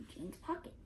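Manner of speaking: ordinary delivery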